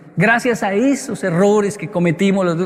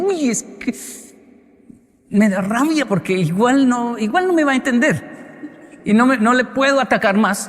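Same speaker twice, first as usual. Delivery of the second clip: frustrated parent; 6 semitones higher than usual. Retold or imitated. Parents' argument voiced.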